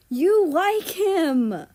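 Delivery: loud, slow, high sing-song accusation; triumphant shout. Acted. Mal crows at Russia that he likes him, slow and loud. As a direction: drag it out, gloating.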